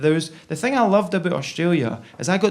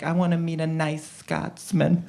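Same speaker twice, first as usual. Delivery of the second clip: put-on American accent; 2 semitones lower, 7 dB quieter than usual. Love-struck American coming over here, mock American accent. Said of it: "Imagine being American".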